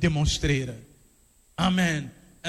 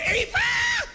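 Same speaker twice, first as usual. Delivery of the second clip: very high cry of a name; acted scream; 4 dB louder than usual. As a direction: scream it out as if calling for help. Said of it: "ga je schreeuwen". (geschreeuwde noodkreet, heel hoog)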